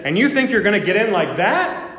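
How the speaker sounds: joking jab. Retold or imitated. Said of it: "he kind of made a joke".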